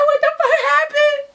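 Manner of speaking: high-pitched, wailing mock sob; crying. Storyteller asks Z about the gunshot, crying. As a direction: cry it out in hysterics, hammed-up. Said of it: "I'm crying".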